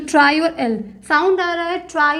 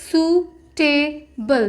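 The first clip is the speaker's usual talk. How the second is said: drawn-out word; much slower than usual. Shown as a demonstration. Teacher to shorthand students, suitable drawn out slowly. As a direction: stretch suitable out very slowly, syllable by syllable. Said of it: "तो word होगा".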